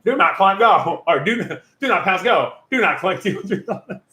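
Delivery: high, wobbling comic movie-character voice; Ace Ventura. Retold or imitated. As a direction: put on a goofy impression with swooping pitch. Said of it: "and he goes".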